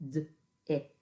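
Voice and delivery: D-ict split into separate sounds; teacher segments for children; sounding out phonemes. Shown as a demonstration. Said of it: "Pinch those phonemes as you say them".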